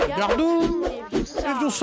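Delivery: magic spell. Shown as a demonstration. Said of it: "Montre-nous, euh, professeur [name]".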